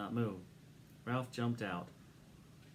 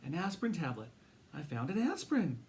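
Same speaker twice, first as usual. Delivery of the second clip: put-on higher, dreamy sick boy; drowsy boy voice; 5 semitones higher than usual. A narrator's reading. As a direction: repeat it like a feverish child. Feverish boy Keith voiced.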